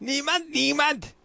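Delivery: high-pitched pretend German; mock German accent. Host playfully trying Churchill in German, mock German accent. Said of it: "imagine that in a German accent".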